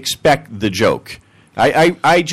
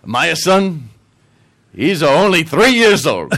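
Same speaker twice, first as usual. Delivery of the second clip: put-on higher, slower, louder Italian accent; 4 semitones higher, 4 dB louder, slower than usual. Italian answering the puzzled men, emphatic Italian accent. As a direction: land the punchline as a proud Italian. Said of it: "And he goes".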